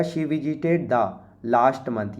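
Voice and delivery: slow, careful reading of the answer; slow dictation. Shown as a demonstration. Teacher dictating the answer sentence slowly to students. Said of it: "reading the example sentence slowly".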